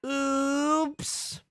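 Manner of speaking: drawn-out, very high squeaked oops; high-pitched mock oops. Real, not acted. Player feeling evil after a move, squeaking a mock oops.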